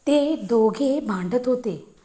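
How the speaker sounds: usual speaking voice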